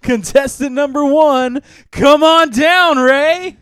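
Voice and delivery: high, loud game show call; game show host. Pretend. Game show host calling contestant.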